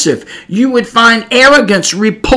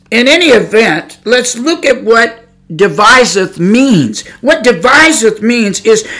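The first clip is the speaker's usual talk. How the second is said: normal talking voice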